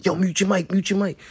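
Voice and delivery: high urgent hiss; urgent high-pitched plea. Retold or imitated. Urgently texting friend to mute.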